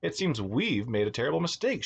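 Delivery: plain reading voice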